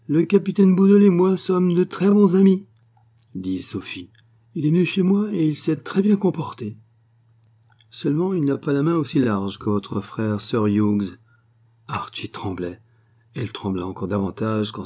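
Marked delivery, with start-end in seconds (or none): 0.1-11.2 higher, louder scheming Russian spy, sweetly insinuating; Sophie's voice. Acted. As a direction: play the schemer, sugary and sly. (voix haute et mielleuse d'intrigante)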